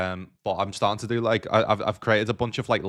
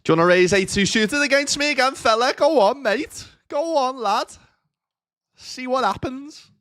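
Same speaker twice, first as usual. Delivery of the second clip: much higher, louder mock-tough bloke voice goading; taunting opponent; 5 dB louder than usual. Streamer taunts the opponent who raised, high mock bravado. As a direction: goad the other player in a shrill put-on lad voice.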